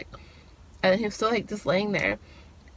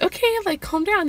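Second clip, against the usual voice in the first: flustered; 10 semitones higher than usual. Retold or imitated. Telling her heart to calm down.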